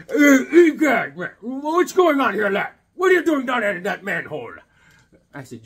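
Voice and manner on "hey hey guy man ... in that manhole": mock the snobby students; imitating Yale students.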